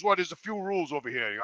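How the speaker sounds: low, quiet, mumbled salesman voice; imitating the salesman. Gym clerk listing prices to Jimmy, low and mumbling. Clerk starts reading rules.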